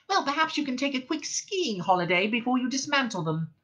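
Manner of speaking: high, biting mouse sarcasm; acid. A narrator's reading. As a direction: needle him sarcastically. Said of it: "said Frankie acidly".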